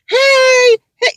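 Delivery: high, bright sing-song "hey, hey"; a hammed-up sassy coworker hello; sister girl greeting. Shown as a demonstration. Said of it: "that's sister girl".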